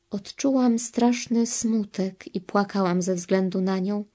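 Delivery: normal talking voice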